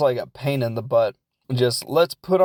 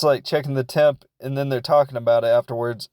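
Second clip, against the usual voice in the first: usual speaking voice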